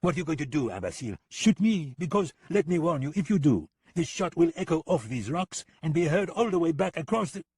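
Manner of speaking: high, mocking sing-song; smug gunman needling the American; teasing Lipov. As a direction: rub it in with fake friendliness. Lipov's character voice.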